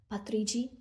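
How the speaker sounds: usual speaking voice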